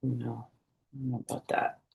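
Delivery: plain reading voice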